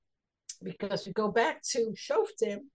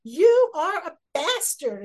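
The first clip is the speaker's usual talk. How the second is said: slow, loud, much higher insult; angry slur; 6 semitones higher, 10 dB louder, slower than usual. Retold or imitated. King Shaul insulting his son, slow loud rage. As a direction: spit the insult out slowly and loud. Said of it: "is what he's saying".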